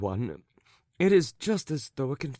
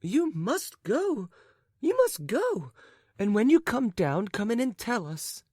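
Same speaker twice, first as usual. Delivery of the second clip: urging cry; 7 semitones higher than usual. A narrator's reading. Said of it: "cried the lady".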